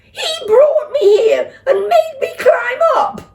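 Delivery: very high, loud crying protest; tearful child shrieking; wailing shriek. Acted. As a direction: sob out the excuse.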